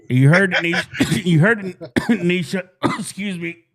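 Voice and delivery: coughing between the words; choked up. Real, not acted. Host trying to talk after Scout King's remark, choked up and coughing. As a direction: keep breaking off to cough, then excuse yourself. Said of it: "got me choked up with that one".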